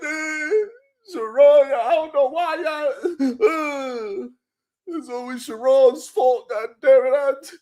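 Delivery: high, whiny mock sob; mock crying man. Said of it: "they like".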